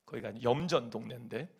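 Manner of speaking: ordinary talk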